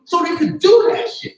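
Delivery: high, loud angry outburst; angry shout. Real, not acted. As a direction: let it burst out in anger. Angry rant about young people.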